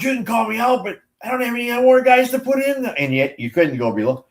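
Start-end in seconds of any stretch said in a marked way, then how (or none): 0.0-2.8 whiny protest. Retold or imitated. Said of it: "he goes".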